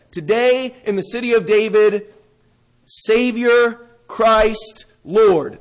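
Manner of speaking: "Savior", "Christ", "Lord" as separate loud blows; each title punched. Shown as a demonstration. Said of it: "here's actually what the angel said".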